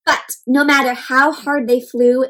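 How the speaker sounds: ordinary delivery, nothing marked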